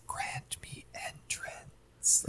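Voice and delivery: very soft, slow whispered temptation; sultry whisper. Retold or imitated. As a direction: whisper it like a seductress. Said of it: "the way that she says".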